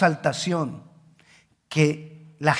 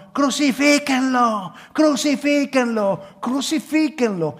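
crowd's cry; 6 semitones higher, 3 dB louder, slower than usual. Retold or imitated. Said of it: "estaban gritando".

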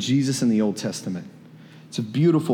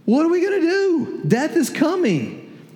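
much higher, strained cry of pretend panic; panicked disciple; 13 semitones higher than usual. Preacher voicing disciples seeing a ghost, panicked high cry. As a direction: voice a terrified disciple who thinks death has come. Imagine you're in this boat.

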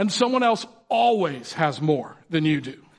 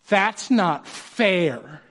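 put-on drawn-out, slow childish whine; whiny kid; much slower than usual. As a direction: stretch it out like a sulking kid. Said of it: "What do they say?".

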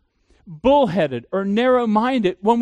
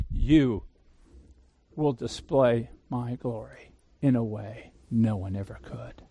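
voice of God; 6 semitones lower than usual. Retold or imitated.

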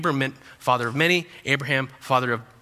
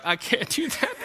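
mock despair; 7 semitones higher, 4 dB quieter than usual. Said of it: "Some of you are like".

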